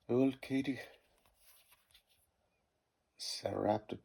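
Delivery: normal talking voice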